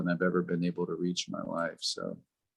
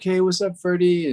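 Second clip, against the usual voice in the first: super nonchalant; 9 semitones higher, 6 dB louder than usual. Retold or imitated. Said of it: "super nonchalant".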